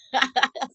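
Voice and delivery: sudden loud laugh; burst of laughter. Real, not acted.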